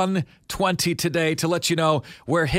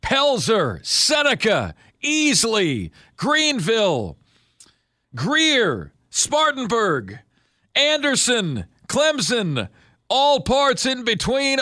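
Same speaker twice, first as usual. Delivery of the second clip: radio announcer; 6 semitones higher, slower than usual. Acted. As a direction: announce each town like a roll call. Radio host hype opener.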